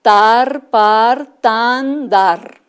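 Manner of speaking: tar, par, tan, dar each loud and separate; clear syllables. Shown as a demonstration. Teacher presenting syllables to children, loud and clear. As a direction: call out each syllable firmly. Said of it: "¿qué sílabas suenan igual?".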